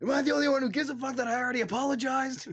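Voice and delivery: high-pitched, worked-up protest; hammed-up indignant questioning; indignant rant. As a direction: plead your case in mock outrage. Parody of indignant movie rant.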